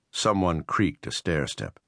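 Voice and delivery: usual speaking voice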